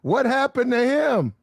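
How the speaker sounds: a hint of an anxious mother; mom's voice. Mom asks about father.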